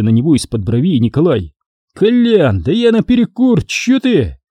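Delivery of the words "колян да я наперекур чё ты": put-on drunk guest voice. A narrator's reading. Drunk guest excusing himself.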